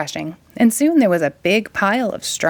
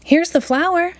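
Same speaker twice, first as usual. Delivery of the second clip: put-on higher, bright call of a boy; excited shout; 10 semitones higher than usual. A narrator's reading. Jack proudly shows the flour to mother, excited shout. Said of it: "shouted Jack".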